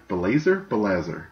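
ordinary delivery

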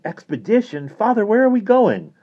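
very high, loud questioning; incredulous boyish outburst; astonished boy. A narrator's reading. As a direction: blurt it out in amazement. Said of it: "Johnny's mouth dropped open".

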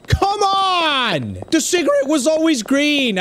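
high drawn-out protest; frustrated, pitch soaring then easing down; exasperated outcry. Real, not acted. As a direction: wail at an unfair loss. Lost the round, protests.

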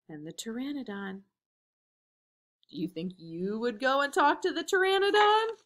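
ordinary delivery, nothing marked

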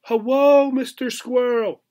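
high, loud calling out to the squirrel; shout. A narrator's reading. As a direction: call out across the gap, hands cupped. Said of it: "Obi shouted".

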